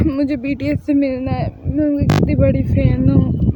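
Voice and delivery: loud, hammed-up wailing fangirl; mock wailing lament. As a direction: wail over your lost idols. Daughter wails about lost BTS dream. (शादी के बाद BTS के लिए नाटकीय रोना-धोना)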